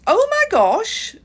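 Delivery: high, loud, drawn-out mock English accent; excited kid in a bad English accent; horrible English accent. London kid amazed to meet her at the airport, horrible English accent. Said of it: "bring out a horrible English accent".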